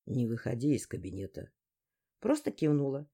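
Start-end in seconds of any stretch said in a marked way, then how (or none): 0.1-1.5 lower, softer male request; male character voice. A narrator's reading. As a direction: ask her to stay put. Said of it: "попросил". (тихая мужская просьба)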